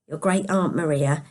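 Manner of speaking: ordinary delivery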